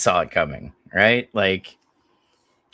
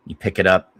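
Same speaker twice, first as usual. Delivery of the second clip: normal talking voice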